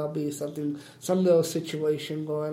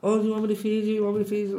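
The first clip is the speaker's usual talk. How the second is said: put-on girls doting on him; 5 semitones higher, 4 dB louder, much faster than usual. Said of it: "they'd be like".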